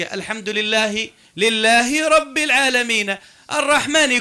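slow Quran recital, case endings sounded; linked recitation. Shown as a demonstration. Teacher showing the words hang together, slow linked recitation. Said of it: "كلها مع بعضها".

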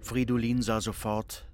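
normal talking voice

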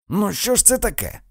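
high exclamation of dismay; exasperated, high-pitched protest. A narrator's reading. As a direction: cry out, pitch jumping well up. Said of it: "вигукнув Фредді".

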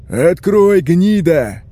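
furious major roaring at a door; loud, raging shout, voice pushed up; bass bellow. A narrator's reading. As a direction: roar the order with full force. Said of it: "орёт басом майор".

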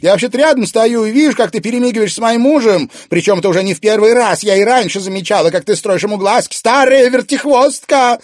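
high, loud, fast furious tirade; jealous rant. A narrator's reading. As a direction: tear into her rival. Jealous wife attacks rival.

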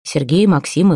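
ordinary delivery, nothing marked

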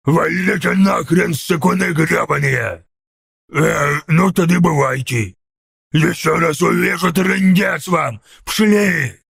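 slow ork bellow at normal volume; angry put-on ork roar, held back; yelled. A narrator's reading. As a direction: chase them off with a stretched-out ork threat. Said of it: "заорал Сниггоп".

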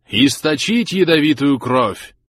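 high, loud, slow proclamation; solemn thunder. Acted. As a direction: proclaim it like a ritual command. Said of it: "торжественно прогремел голос старца".

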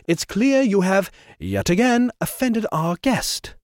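smooth, superior intervention; fussy gentleman. Acted. Trevor voiced.

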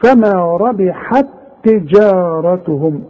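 slow, measured reading running ربحت straight into تجارتهم; joined recitation. Shown as a demonstration. Teacher showing how to read it when joined, slow recitation with one doubled ت. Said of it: "اذا هقولها عند الوصل تاء واحدة".

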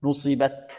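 نصبت ending in a released, whispered puff on ت; breath on final ta. Shown as a demonstration. Teacher showing hams, soft breathy release on final ta. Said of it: "فيها صفة الهمس".

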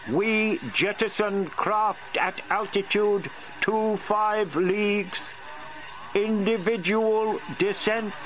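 an insect leader issuing orders; powerful alien commander. Acted. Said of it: "louder, more powerful".

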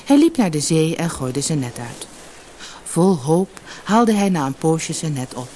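plain reading voice

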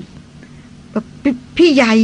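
halting, very slow blurt of the words; a young woman tripping over her words, slow; flustered stammer. A narrator's reading. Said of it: "ส่วนดารินก็อึกอักอึกอัก".

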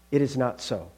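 low, soft, firm denial; calm angel. Acted. Angel denying it would kill the ghost, calm. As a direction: contradict calmly with quiet certainty.

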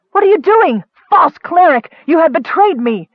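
high, loud, frantic outcry; outraged accusation. A narrator's reading. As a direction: accuse him in panic and fury. Sister accuses cleric of betrayal.

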